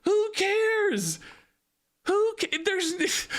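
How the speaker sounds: high, exasperated outburst; frustrated. Real, not acted. Streamer venting at commenters, frustrated outburst. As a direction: blurt it out in exasperation. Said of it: "I'm sorry I'm getting frustrated".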